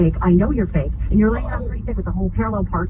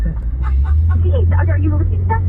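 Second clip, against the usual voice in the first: ordinary talk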